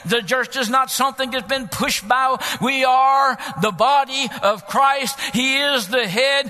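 high, loud rallying sermon shout; full-force preaching. Acted. Preacher rallying the church to go out, full-force shouted preaching. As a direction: punch it out in rising revival cadence.

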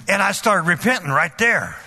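usual speaking voice

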